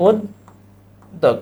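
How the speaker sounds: উদ্যোগ stretched slowly, doubled দ held; slow pronunciation demo. Shown as a demonstration. Teacher showing the doubled sound in উদ্যোগ, slow. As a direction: draw out উদ্যোগ. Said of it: "কি হবে?".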